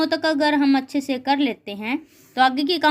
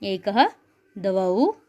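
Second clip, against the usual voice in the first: lower, measured chant of the numbers, one word at a time; recitation drill; 5 semitones lower, much slower than usual. Shown as a demonstration. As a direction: lead the class through the count, word by word. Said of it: "एक बार हम दोबारा एक बार उच्चारण कर लेते हैं".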